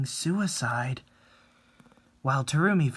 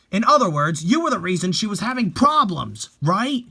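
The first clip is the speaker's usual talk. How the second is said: louder, higher accusing rant; angry accusation; 7 semitones higher, 7 dB louder than usual. Acted. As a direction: corner him with blame. Fan accuses the boyfriend.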